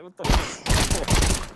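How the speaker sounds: loud, slow, high outraged shout; outraged yell. Real, not acted. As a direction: yell it out in disbelief. Outraged at a bad teammate play.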